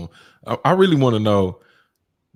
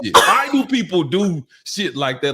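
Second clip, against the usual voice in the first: exasperated; 10 semitones higher, 8 dB louder than usual. Real, not acted. Exasperated rant about Flint jokes.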